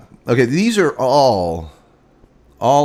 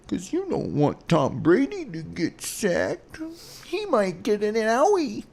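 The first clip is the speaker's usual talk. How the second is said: put-on higher, softer, cooing baby-talk voice; mock baby talk; 10 semitones higher, 7 dB quieter than usual. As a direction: coo it like soothing a toddler, mocking. Mocking Brady as fragile baby.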